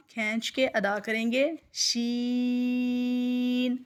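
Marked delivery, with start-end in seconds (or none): 1.7-3.8 شین stretched three to five counts, no nasal; long drawn sheen. Shown as a demonstration. Teacher drawing out شین for pupils. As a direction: hold شین long without going through the nose. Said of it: "تین سے پانچ الف کے جتنا".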